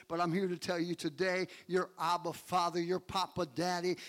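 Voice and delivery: ordinary talk, nothing marked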